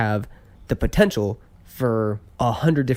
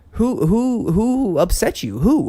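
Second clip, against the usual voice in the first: higher-pitched, exasperated questioning; mock interrogation; 7 semitones higher, slower than usual. Man imagining asking the upset diner who wronged her, exasperated. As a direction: demand an answer in mock disbelief.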